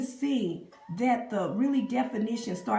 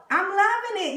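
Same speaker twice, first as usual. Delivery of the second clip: high, loud and beaming; thrilled with her own look, beaming; delighted; 11 semitones higher, 6 dB louder than usual. Real, not acted. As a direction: gush with real delight. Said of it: "I'm smiling".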